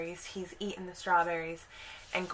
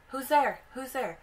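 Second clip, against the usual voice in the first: nervous calling out; 6 semitones higher, 3 dB louder than usual. Retold or imitated. Boy calling into dark forest.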